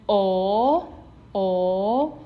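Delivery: "o" drawn out, sliding upward; rising tone. Shown as a demonstration. Teacher modelling the second tone, rising.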